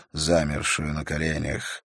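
ordinary delivery, nothing marked

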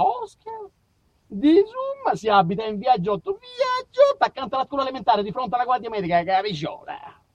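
over-eager villager explaining; hammed-up villager giving directions; local yokel directions.

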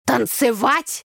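a touch of horror, slow; mock horrified. Brother asked to dance, mildly horrified. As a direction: act appalled at the idea. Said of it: "ужаснулся брат".

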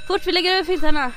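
quick urgent instruction; hurried. Acted. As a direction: rattle it off while hiding the safe. Hurrying to hide the safe.

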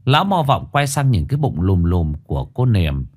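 plain reading voice

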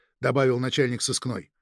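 plain reading voice

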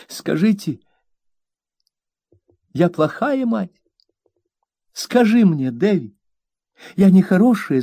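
high, aggrieved plea; mother scolding her maid, high and hurt; wounded young mother. A narrator's reading. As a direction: play the hurt, fragile mistress. Mother pleading with son.

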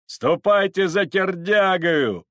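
high, slow, loud call; drawn-out shout from the crowd; shouted order. Acted. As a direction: send them off with a yell. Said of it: "закричали".

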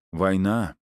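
usual speaking voice

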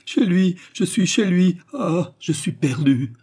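very high, loud frantic wail; crazed despair. A narrator's reading. Woman believing herself ruined, crazed despair. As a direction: cry it out as if going mad. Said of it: "répéta avec l'accent de la folie".

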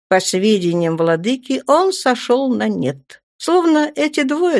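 plain reading voice

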